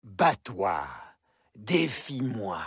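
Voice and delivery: normal talking voice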